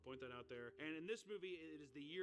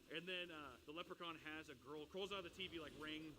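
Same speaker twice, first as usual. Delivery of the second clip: normal talking voice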